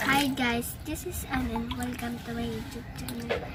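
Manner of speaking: soft, low-energy channel greeting; beginner voice demo. Shown as a demonstration. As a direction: say it small and quiet, like an untrained voice. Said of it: "Ganito po ang boses ko, one day voice lesson".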